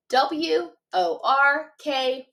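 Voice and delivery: letters spaced out slowly; slow spelling. Shown as a demonstration. Teacher spelling a tricky word slowly for students. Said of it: "Watch me first".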